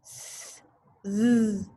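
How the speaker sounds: S hissed with no voice, z buzzed with voice; sound demo. Shown as a demonstration. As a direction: hiss the S, buzz the z. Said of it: "Say it with me".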